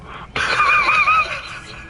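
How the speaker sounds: very high-pitched squeal after a shock; high shrieking outburst. Real, not acted. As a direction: let out a high, shrieky wail. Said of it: "The scream that came from me".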